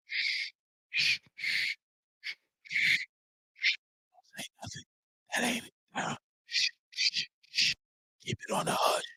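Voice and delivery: hushed, breathy shushing, barely voiced; mock whisper. Imitating the hush-hush boxing world in a whisper. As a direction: shush them like a secret. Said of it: "What is you whispering for?".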